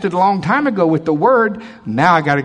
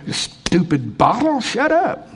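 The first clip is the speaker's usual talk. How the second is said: mock scolding; 7 semitones higher, slower than usual. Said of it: "we go around the house".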